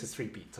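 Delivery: firm low repeat to drive it home; stress on three. Shown as a demonstration. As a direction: drop "three" low and quiet.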